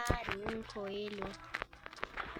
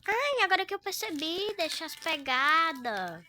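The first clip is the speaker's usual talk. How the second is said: surprised; 6 semitones higher, 5 dB louder than usual. Real, not acted. Realizing in a tense game.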